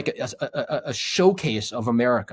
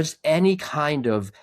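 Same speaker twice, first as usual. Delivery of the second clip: usual speaking voice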